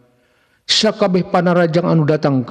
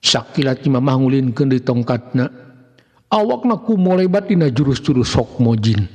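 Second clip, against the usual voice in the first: usual speaking voice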